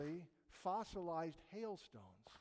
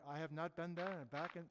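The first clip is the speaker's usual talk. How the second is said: lightly imitated German accent, a little lower; 3 semitones lower, 5 dB quieter than usual. Voicing Hans the German volcanologist by email, light German accent. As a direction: put on a mild German accent. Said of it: "this is my German accent now".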